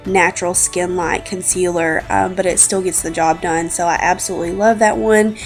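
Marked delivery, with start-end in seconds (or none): none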